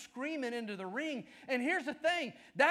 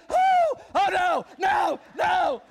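high, loud mock panic as he bails out; hammed-up yelp of fleeing villain; panicked bad guy; 8 semitones higher, 11 dB louder, much slower than usual. The fleeing bad guy panicking as he escapes, hammed-up loud. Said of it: "and he's like".

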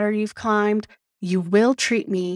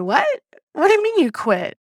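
high, quick disbelief; shocked; 8 semitones higher, 4 dB louder, faster than usual. Retold or imitated. Boss hearing her employee quit, shocked. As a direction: imitate a boss caught off guard, pitched up. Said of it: "She was shocked, like, genuinely shocked".

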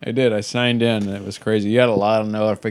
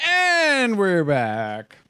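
announcer voice; 8 semitones higher, much slower than usual. Acted. Radio host return announcement.

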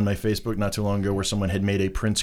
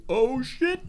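shocked yelp; 13 semitones higher, 5 dB quieter than usual. Retold or imitated. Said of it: "look on his face".

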